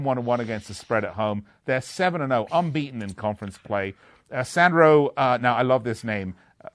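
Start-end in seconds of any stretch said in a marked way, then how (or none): none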